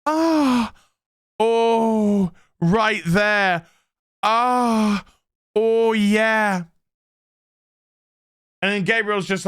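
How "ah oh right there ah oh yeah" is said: mock moaning. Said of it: "his mum is there on the table going".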